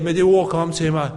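ordinary talk

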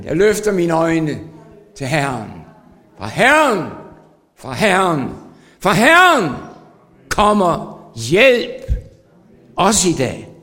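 triumphant declaration; preaching at full force. Acted. Psalm proclaimed with peak.

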